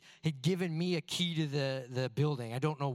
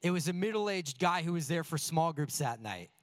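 usual speaking voice

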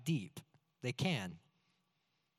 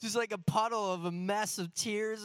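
mock tearful whimper; 6 semitones higher than usual. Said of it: "I'm sitting there like".

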